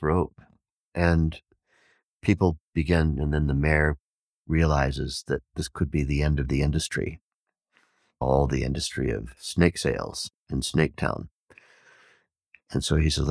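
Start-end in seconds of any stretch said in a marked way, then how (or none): none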